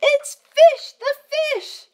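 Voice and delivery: high cartoon fish reveal; silly fish voice. Voicing animals in picture book.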